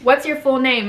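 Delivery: normal talking voice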